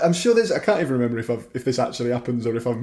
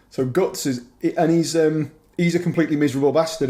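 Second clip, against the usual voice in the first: ordinary delivery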